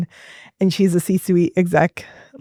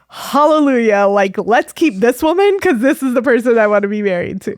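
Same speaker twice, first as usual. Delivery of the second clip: imitated rejoicing, higher-pitched; jubilant; 4 semitones higher than usual. Husband to therapist about his changed wife, jubilant. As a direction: play a relieved husband celebrating, voice lifted.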